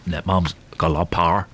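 deep, low put-on presenter voice; low-pitched celebrity impression; Eamon Holmes. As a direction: drop low and give a grave warning. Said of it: "Do it in your voice".

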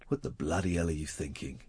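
very low, hushed, deadpan menace. Acted. Star guitarist stopping his solo to scold a chatterer, low and quiet. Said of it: "he'd just say, 'cause I know [name]".

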